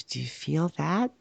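slow, hushed menacing question; quiet menacing voice. Retold or imitated. Haunted house actor whispering to him.

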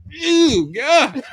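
high, loud, revolted outburst; acted disgust. Inspector smells the next meat case, loud acted disgust. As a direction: recoil loudly from a foul smell. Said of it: "here's the face he makes".

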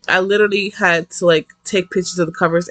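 usual speaking voice

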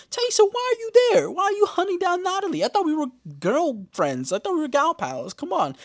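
hammed-up squeaky hurt friend; betrayed friend. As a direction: plead like a hurt gal pal. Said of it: "I'm like".

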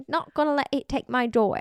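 repeating the mock British accent; light Pirates of the Caribbean British voice. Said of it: "I was".

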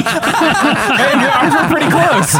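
laughing reply. Real, not acted. Joking amid group laughter.

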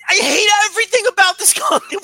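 high, loud laughing outburst of hate; laughing shout. Real, not acted. Ranting about the company he hates, laughing shout. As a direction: yell it, breaking into laughter.

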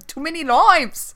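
high, swooping stage Irish; hammed-up Irish catchphrase, pitched high; mock Irish accent. Co-host topping the Irish bit, mock Irish accent.

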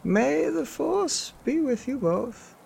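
very high, slow, solemn blessing; wise Grand Master voice. Acted. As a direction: bless them slowly from far above.

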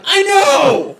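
loud high shout; shouted reply. Retold or imitated. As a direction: call out at the door. Answering the knock.

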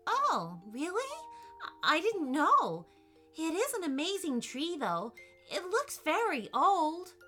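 high-pitched, wondering response; surprised girl. A narrator's reading. Allison hearing the oak is magical, surprised.

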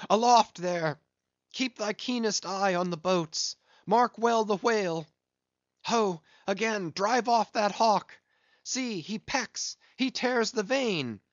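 high, loud hail aloft; shouting up the mast. A narrator's reading. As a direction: yell up to the lookout. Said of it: "hailing aloft".

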